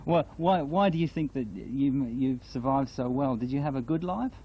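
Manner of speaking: ordinary talk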